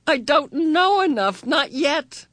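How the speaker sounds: high anguished cry; cracking tearful heartbreak. Acted. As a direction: voice breaking with despair. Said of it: "cried in an anguished voice".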